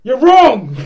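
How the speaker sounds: loud high mock Darwin yell; Darwin shouting. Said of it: "He'd go".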